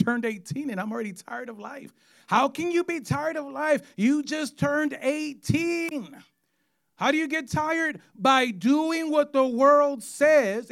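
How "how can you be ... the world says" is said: higher, slow preaching questions. Acted. Preacher challenging the congregation, higher and slow. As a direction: press the crowd with the question.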